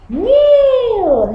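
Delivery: high, drawn-out meow; cat meow. Shown as a demonstration. Teacher prompting the class, a high meow. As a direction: mew it up high like a kitten. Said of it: "A cat what?".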